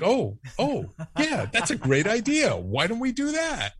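a high, bright-voiced sudden enthusiasm; eager agreement. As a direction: catch the idea and run with it. Wife suggests buying the business.

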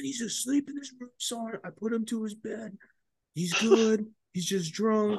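squeaky friend covering for him; high mock buddy voice. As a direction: play the nervous buddy in a high voice.

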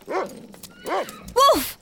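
high call to the dog; shouted dog's name. Acted. Calling the dog away.

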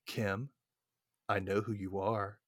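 low, soft retold menace; hushed menace. Stranger surprises her at the door, hushed menace. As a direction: drop it low and quiet, like a stalker. Retold creepy caller in movie.

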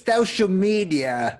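slow, high British drawl on Social media; mock Russell Brand, stretched and lofty. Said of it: "should I put on my Russell Brand voice".

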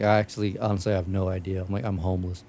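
mock-serious dead-calm confession; deadpan. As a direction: drop it flat and low like it's nothing. Deadpan reply to salesman.

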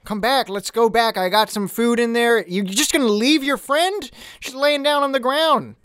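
high, anxious peacemaker; calm them down, eager to talk; character voice. Acted. Player voices Dak.

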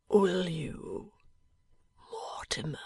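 barely voiced, dying breath; a dying man's faint whisper. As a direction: whisper it weakly from a sickbed. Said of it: "he said something in a whisper".

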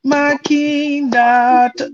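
drawn-out, very slow mock movie line; mock Color Purple quote. Friends teasing each other, slow drawled movie quote. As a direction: stretch it out like a film quote.

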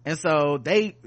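ordinary talk, nothing marked

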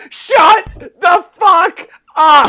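loud, slow, high shouting; hammed-up rage at the King; mock furious yell. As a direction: bellow it at the announcer. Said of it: "John's like".

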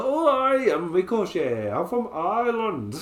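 high, drawn-out mock Irish accent that wanders; hammed-up American attempt at sounding Irish; Ricochet's fake Irish accent. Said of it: "trying to do an American being an".

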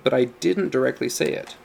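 usual speaking voice

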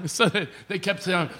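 ordinary delivery

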